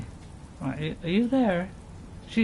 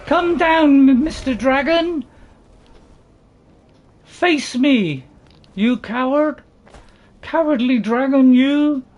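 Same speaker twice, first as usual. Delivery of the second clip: high, raised call to battle; mock-heroic challenge; 5 semitones higher, 6 dB louder, slower than usual. Player taunting a dragon to come down, loud mock-heroic. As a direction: call the beast out, loud and hammed-up.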